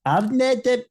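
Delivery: high-pitched mock South African attempt; mock South African accent. Neighbour attempts a Scottish whisky name, in mock South African accent. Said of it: "doing a South African impression".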